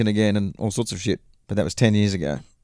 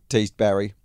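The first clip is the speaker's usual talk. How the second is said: plain reading voice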